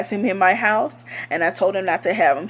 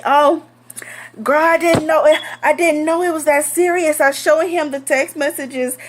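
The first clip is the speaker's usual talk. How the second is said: high, airy excuses; mock girlfriend; 6 semitones higher than usual. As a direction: play the innocent friend. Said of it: "this bitch gonna tell me".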